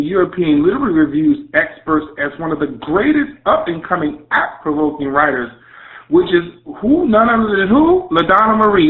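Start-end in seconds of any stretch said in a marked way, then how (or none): 6.1-8.9 which is who? pitched way up, building to the name; hype announcement. Acted. Host hyping the guest to listeners, excited. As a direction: build the crowd up to her name.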